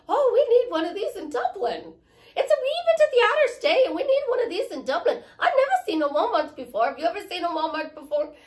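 high, loud, hammed-up Irish accent; poor Irish accent. Shown as a demonstration. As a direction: play a gushing Irish tourist, high and quick. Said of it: "I do a poor Irish accent".

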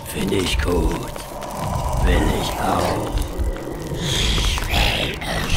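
low, slow menacing demon growl. Acted. Narrator's demon taunting the four surgeons, low and slow. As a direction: let the demon inside speak, slow and low.

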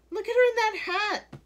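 high, loud outraged cry; very high, loud accusation. A narrator's reading. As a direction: shriek it from the crowd. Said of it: "cried one".

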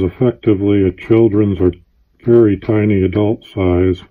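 muffled behind rubber mask; speaking through mask filter; through a gas mask. Real, not acted. Seen 5: Full gas mask covers the speaker's face throughout the labelled words.

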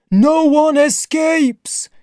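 loud, very high drawn-out chant; slow, high communal cry; crowd echo. Acted. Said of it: "repeated the rest of the crowd".

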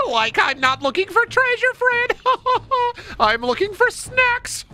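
very high, goofy cartoon squeal, giggling; Shaggy. Shaggy tells Fred he wants snacks, squealing. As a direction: crack upward like a silly sidekick. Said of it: "All right, Shaggy".

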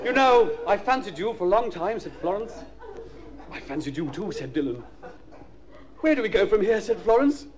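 put-on high-pitched kids' TV storyteller; mock children's story narration. Comedian reading a Magic Roundabout script, childlike storytelling voice. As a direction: read it high and simple, as if to little children.

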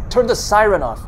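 high, loud yelped order; urgent yelp. A narrator's reading. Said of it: "his partner yelps".

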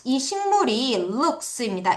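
normal talking voice

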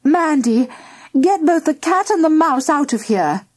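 high, urgent mother's order; crying out. A narrator's reading. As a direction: cry out an order to a daughter. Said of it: "cried her mother".

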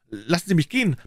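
a high, scared plea to be let go; frightened girl crying out to be released. A narrator's reading. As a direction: cry out in fright, pitched high. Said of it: "schrie sie erschreckt".

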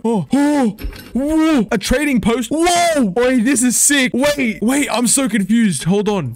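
high, excited burst of disbelief; thrilled amazement. Real, not acted. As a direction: exclaim high, overwhelmed by the discovery. Discovers trading post.

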